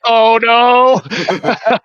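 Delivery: high, loud, held-out wail; mock dread. Driver watching the bead hit the limit, mock dread. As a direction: ham up a helpless cry of doom. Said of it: "You're like".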